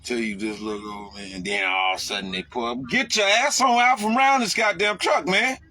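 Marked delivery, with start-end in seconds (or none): 2.9-5.6 high, loud yell at a driver cutting in; angry yell. Retold or imitated. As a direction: shout it out the window at the car. Yelling at car driving alongside.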